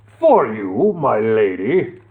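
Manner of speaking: slow, courtly knight voice; gallant knight. Acted. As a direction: present it gallantly. Gallant knight presenting flag.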